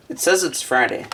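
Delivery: normal talking voice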